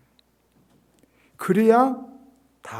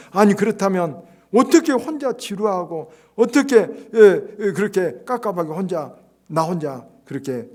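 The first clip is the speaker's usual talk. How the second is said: loud, higher indignant complaint; loud protest; 5 semitones higher, 9 dB louder than usual. Acted. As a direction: protest it loudly from the pulpit. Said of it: "그러자 목회자 말했습니다".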